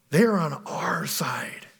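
slow whisper; whispered claim. Retold or imitated. Eliphaz telling Job the elders side with them, slow whisper. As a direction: hush it like a secret threat.

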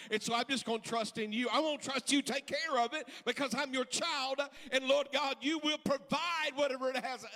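loud, rising preacher's cadence; preaching at full force. Acted. Preacher pressing the congregation, full force. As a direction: build the sermon to a roar.

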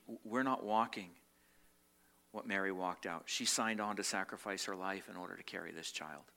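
ordinary delivery

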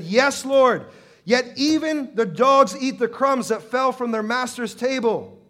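loud, high, defiant plea; bold, insistent comeback; chutzpah. Acted. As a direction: answer back boldly at full voice. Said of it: "That's chutzpah".

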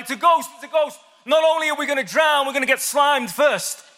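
high, hammed-up panic shout; freaking out. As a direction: play Peter shouting in comic terror. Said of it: "And he's freaking out".

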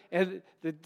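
usual speaking voice

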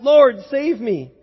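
loud, drawn-out plea; crying out for help. A narrator's reading. Sinking disciple calls to his Lord, crying out. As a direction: call out to be saved.

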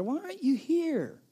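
high, pleading cry; desperate plea. Pretend. The worried believer cries to the absent Lord, high desperate plea. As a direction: beg him to come, voice straining up.

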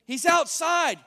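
put-on girl insisting Peter is outside; insistent. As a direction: plead that he's really there. Acted quarrel at the gate.

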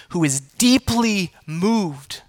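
deeply drawn out slowly and weightily; slow emphasis. Acted. Stressing God's compassion.

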